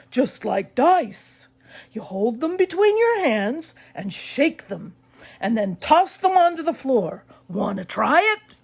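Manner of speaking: put-on a dad tempting the kids to play; Mister Malloy. A narrator's reading.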